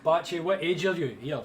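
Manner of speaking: ordinary talk, nothing marked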